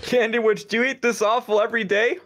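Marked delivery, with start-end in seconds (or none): none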